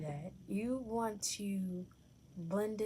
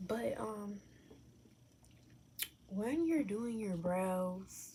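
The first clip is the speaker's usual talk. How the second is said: ordinary talk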